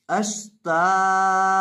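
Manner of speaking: अश्तातन read very slowly with each syllable held; drawn-out reading. Shown as a demonstration. Teacher showing students the whole word read slowly. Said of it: "ता दो ज़बर तन".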